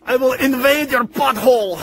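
hammed-up Russian accent, booming villain boasts; Nikolai Volkov. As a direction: stake a claim as a mock Russian villain, with evil laughs. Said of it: "I am Nikolai Volkov".

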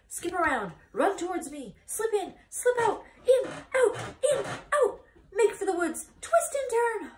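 high, loud rapid-fire orders; bossy goose. A narrator's reading. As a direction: shriek commands like a frantic goose. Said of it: "The goose took command and began to give orders".

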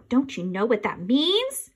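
plain reading voice

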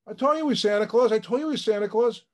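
high, loud excited cry of vindication; mother screaming. Retold or imitated. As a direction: yell it out like a thrilled mom who knew all along. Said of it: "his mother screams".

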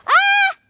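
high loud yelp from cold spray; startled shriek. Real, not acted. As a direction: shriek when the water hits. Getting sprayed with water.

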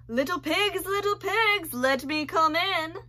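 loud, high wolf demand. Acted. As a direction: insist like an impatient wolf. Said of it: "demanded the wolf".

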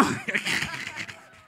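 a quick airless chuckle; breathy laugh. Real, not acted. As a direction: laugh under your breath at yourself. Laughing at own flower story.

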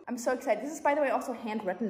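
ordinary talk

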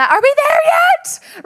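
high, loud mock child yell; shouting. Kid shouting over dad's radio on a road trip. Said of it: "I just talked louder and louder".

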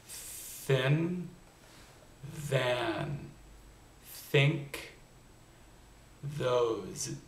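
each word said slowly and on its own, with the TH held clearly; TH sound demo. Shown as a demonstration. Accent coach showing students both TH sounds, word by word, carefully. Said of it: "sounds a little bit like this".

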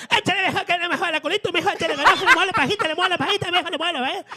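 put-on Veracruz accent. Showing off women's accents, high Veracruz singsong. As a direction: play a flirty Veracruz woman, sing it up high. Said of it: "en los acentos".

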